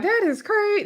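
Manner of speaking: mock high that is crazy. Mel dismissing the claim, a high mocking that is crazy. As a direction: lift it high in disbelief. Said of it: "Mel was like".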